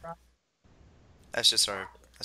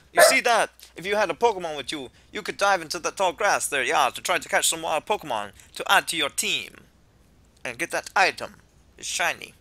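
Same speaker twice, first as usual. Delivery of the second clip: put-on easygoing would-be Jamaican accent; attempted Jamaican accent; 7 semitones higher, 11 dB louder than usual. Kukui welcomes the player to Alola, attempted Jamaican accent. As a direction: do an amateur Jamaican voice, hammed-up. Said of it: "I know I can't do Jamaican".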